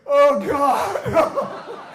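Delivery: high, very loud, laughing; panicked laughing oh god; laughing dread. Retold or imitated. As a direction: cry it out in mock panic. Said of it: "And I was like".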